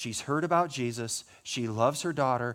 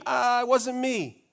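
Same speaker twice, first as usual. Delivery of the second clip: mock defensive excuse; 10 semitones higher, 6 dB louder, slower than usual.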